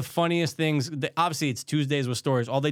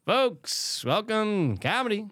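comedian impression; 7 semitones higher, much slower than usual. Shown as a demonstration. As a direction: greet the crowd like a club comic. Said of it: "Give me yours".

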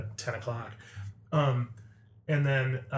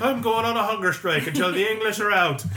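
mock Irish protester; 8 semitones higher, 7 dB louder than usual. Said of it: "it's like".

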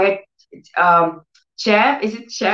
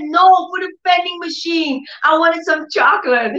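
put-on high, eager child voice; daughter's explanation; 8 semitones higher than usual. Daughter explaining about the vending machine, high eager voice. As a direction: voice the girl wanting chocolate, pitched up. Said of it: "She said".

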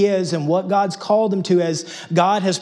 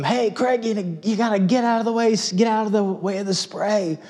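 grandfather yelling; 5 semitones higher than usual. Retold or imitated. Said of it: "yells out to him".

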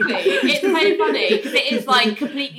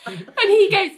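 ordinary delivery

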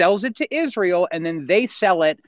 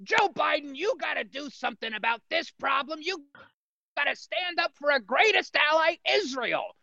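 higher, pushy TV pundit voice; Sean Hannity impression; 9 semitones higher, 6 dB quieter than usual. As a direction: hector like a fired-up cable host. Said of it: "he's, like, poking his finger".

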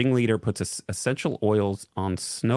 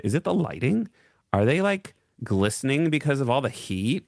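higher voice, questioning lilt; uptalking influencer; 4 semitones higher than usual. Acted. Quoting a content creator, higher uptalking voice. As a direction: pitch it up and lift every phrase end.

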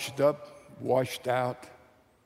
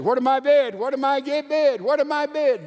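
higher, louder auctioneer calling for bids; 8 semitones higher, 4 dB louder than usual. Retold or imitated. Auctioneer calling bids over the crowd, loud calling. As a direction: call out the bids like a slave market auctioneer. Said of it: "the auctioneer said".

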